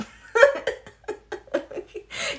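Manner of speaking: high loud laugh; big laugh. Real, not acted. Laughing at her own mom warning, high and loud. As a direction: laugh hard.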